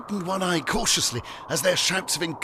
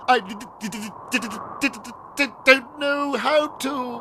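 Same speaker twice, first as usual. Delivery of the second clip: frightened stammer; 7 semitones higher, 3 dB louder than usual. A narrator's reading. Fiddly tells Algernon he is helpless, stammering. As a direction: get stuck on the consonant, then push through. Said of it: "he stammered".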